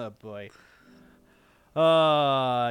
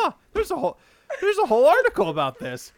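surprised high voice; 11 semitones higher, 4 dB louder, faster than usual. Retold or imitated. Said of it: "I was like".

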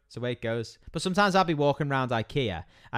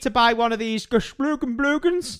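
mock Swedish; 8 semitones higher than usual. Husband mocks ikea names.

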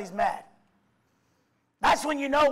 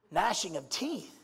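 ordinary talk, nothing marked

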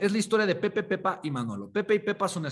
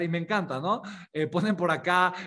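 ordinary delivery

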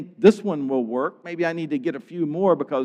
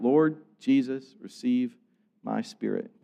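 dying cry, subdued; 4 semitones lower, 5 dB quieter than usual. Retold or imitated. Stephen crying out while being stoned, faltering and fading. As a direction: break it up with pauses, sinking lower. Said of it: "Remember what's happening with him. And he's crying out".

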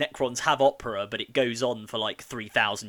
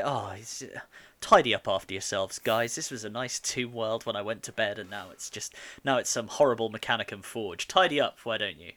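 ordinary talk

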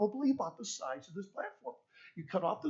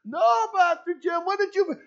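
put-on high, loud chorus of kids answering; children's voices; 12 semitones higher, 9 dB louder than usual. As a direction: play the whole class answering at once.

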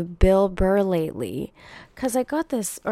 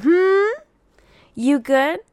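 much higher, louder teasing; high, louder playful prod; teasing high hmm; 11 semitones higher, 5 dB louder, much slower than usual. Acted. Teasing viewers on shopping.

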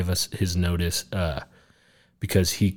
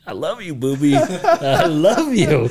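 high, laughing, stretched-out I love you, boobie; laughing impression of his wife cooing at him; mock wife voice; 8 semitones higher, 8 dB louder, slower than usual. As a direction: ham up the wife's sappy voice, dragging the last I love you. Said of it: "That's just the voice".

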